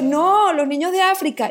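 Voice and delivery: keen retold protest about the children of Africa; eager protest. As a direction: protest brightly, bargaining. Excited bargaining with God, retold.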